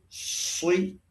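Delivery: SI drawn out with a heavy, thick s; thick emphatic shad. Shown as a demonstration. Teacher showing no need to purse lips, thick SI. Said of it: "Jadi nggak ada pengaruhnya".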